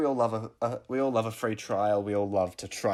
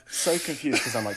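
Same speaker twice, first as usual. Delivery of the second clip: ordinary talk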